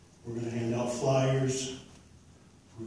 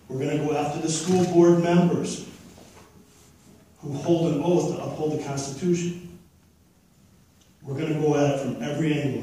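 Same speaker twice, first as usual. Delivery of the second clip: loud; 3 semitones higher, 6 dB louder than usual. Real, not acted. Rally speech threat to board.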